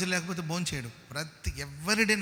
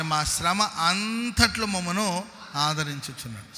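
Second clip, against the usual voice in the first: slow, higher solemn Bible reading; scripture reading; 3 semitones higher, 6 dB louder, slower than usual. Acted. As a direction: read the verse slowly, with weight. (నెమ్మదిగా గంభీరంగా వచన పఠనం)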